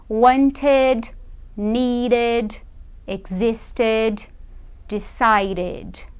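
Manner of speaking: slow word list: "wanted, needed, existed, decided"; careful model reading. Shown as a demonstration. Teacher rereads past verbs for learners, careful "ed" endings in "wanted, needed, existed, decided". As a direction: give every "ed" its own syllable in "wanted, needed, existed, decided". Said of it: "vou ler pra vocês o verbo no passado".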